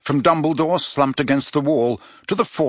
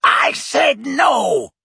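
higher, louder, very slow shout; furious shout; 12 semitones higher, 5 dB louder, much slower than usual. A narrator's reading. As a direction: roar the refusal. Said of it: "shouted the brutal-faced man".

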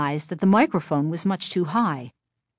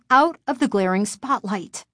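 ordinary delivery

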